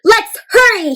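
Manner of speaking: high, loud, bursting with eagerness; eager, high-energy shout; excited exclamation. A narrator's reading. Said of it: "he exclaimed".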